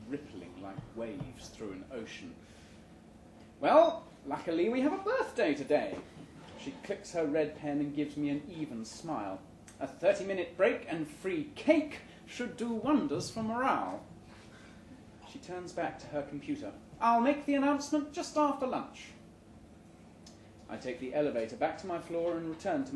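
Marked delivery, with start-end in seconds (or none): 3.6-6.0 louder, perky office manager voice; HR head. Acted. HR head speaks brightly. 9.8-14.0 higher, perky office manager voice; HR head. Acted. HR head speaks. 17.0-19.1 higher, perky office manager voice; HR head. Acted. HR head speaks.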